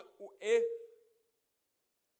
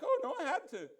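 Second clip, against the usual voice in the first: mock whiny, louder excuse; 7 semitones higher, 5 dB louder than usual. Believer excusing himself to God, mock whiny. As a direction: whine the excuse like a caught child.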